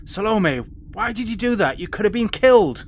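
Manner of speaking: captain scolding Salome; upset rebuke. Acted. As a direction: scold her in alarm. Torrin upbraiding Salome.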